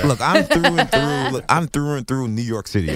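mock New Yorker boasting his roots; put-on tough New York accent. EJ insists he's a New Yorker, mock accent. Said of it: "worst accents imaginable".

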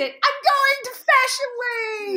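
high, slow, savoured announcement; thrilled announcement. Retold or imitated. Posting her news on Facebook, thrilled. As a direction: draw out each word with delight.

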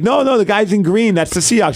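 retold pleading explanation; insistent dad explaining. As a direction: explain it to a doubting child. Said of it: "And I'm like".

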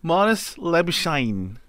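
"Marnus Labuschagne" said slowly, Australian style, "Labuschagne" as lab-uh-shane; slow careful Australian "Marnus Labuschagne"; Australian pronunciation. Shown as a demonstration. Host asks him to pronounce the name like an Australian, slow Australian accent. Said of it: "You need to pronounce it like an Australian".

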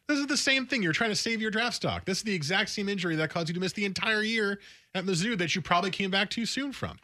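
high-pitched, insistent calling-out; incredulous challenge. Acted. Mock-confronting the player over his excuse.

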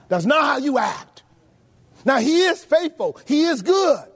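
rising rebuke from the pulpit; full-force preaching. Acted. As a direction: hammer the point home from the pulpit. Preacher rebukes the self-centred.

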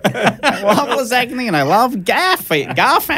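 mock Aussie.

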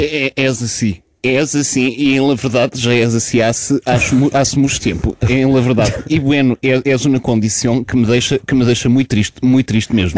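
Portuguese with Spanish words and no Spanish lilt; Spanish-flavoured Portuguese said like a robot; flat Spanish-Portuguese. Acted. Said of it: "parece um robô".

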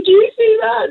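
high, urgent retold question; eager whisper-shout to sister; excited. Retelling her vision to her sister.

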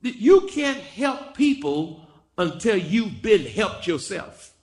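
high, slow, emphatic pulpit delivery; forceful preaching. Real, not acted. Preacher exhorts those who would help people, high and forceful. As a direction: press each phrase on the congregation.

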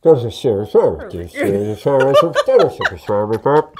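low, muffled intercom mumble; mock garbled pilot. Passenger mimics the pilot he misheard, low muffled mumble. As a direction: mumble it like a crackly pilot announcement.

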